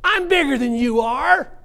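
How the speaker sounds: high, loud, slow devil brag; devil boasting. Acted. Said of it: "the devil spreading himself out saying".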